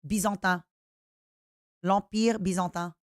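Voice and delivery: Byzantin in French, bee-zahn-tan with nasal ends; l'Empire Byzantin said as French, lahn-peer bee-zahn-tan; French pronunciation. Shown as a demonstration. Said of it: "how the word is in French".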